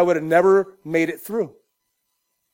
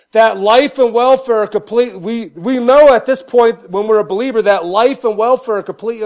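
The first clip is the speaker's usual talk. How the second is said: loud, raised preaching voice; 4 semitones higher, 6 dB louder than usual. Acted. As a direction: preach it out to the church. Preaching at full force.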